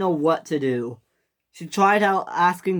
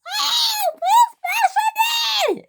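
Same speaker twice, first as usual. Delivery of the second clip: panicked shriek; slower than usual. A narrator's reading. Millie pushed by Luke, panicked screaming. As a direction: shriek it as if being shoved.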